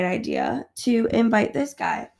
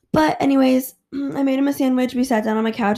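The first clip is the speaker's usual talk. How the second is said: normal talking voice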